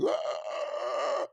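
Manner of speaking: breathy mock scream of despair; mock wail. Mimicking weirdos screaming in the streets, mock wail. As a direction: let out a fake anguished wail. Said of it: "they're screaming like".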